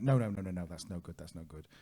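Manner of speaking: lightly acted annoyed dismissal; retold angry refusal. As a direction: imitate a cross taxi driver rattling off refusals. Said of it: "seeming he was quite angry".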